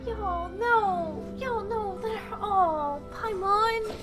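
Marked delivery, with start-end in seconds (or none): none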